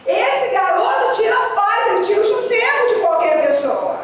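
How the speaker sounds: put-on high-pitched, worked-up grumble about a kid; exasperated complaint. Adult grumbling about a troublesome boy, exasperated. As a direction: complain it high, letting it slide down at the end.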